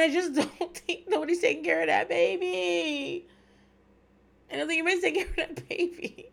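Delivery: high, tearful whine with laughter in it; emotional. Real, not acted. Podcaster fretting that nobody looks after the baby, emotional whine. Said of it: "gets me so emotional".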